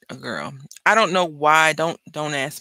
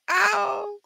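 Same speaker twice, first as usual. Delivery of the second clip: sharp high yelp of pain; mock cry of pain; 3 dB louder than usual. Telling about the C-section, yelping ow in pain. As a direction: yelp it like the first cut hurts. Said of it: "And I'm in pain".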